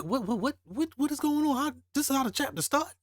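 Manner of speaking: can't believe what's happening, spill it out fast; stunned disbelief. Real, not acted. Reader reacting to how the chapter starts, stunned and high.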